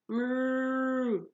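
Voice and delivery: long, high lowing moo; cow moo. A narrator's reading. The cow serpent calling to Percy at the dock, a drawn-out moo.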